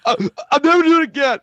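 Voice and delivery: very high, loud mock wail; hammed-up kid begging for forgiveness; wailed plea. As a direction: wail it like a punished child. Child begging forgiveness, retold.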